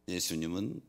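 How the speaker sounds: ordinary delivery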